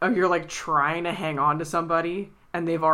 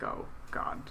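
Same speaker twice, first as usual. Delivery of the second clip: whispered realization; 10 dB quieter than usual. Retold or imitated. Eleanor realizing, to herself.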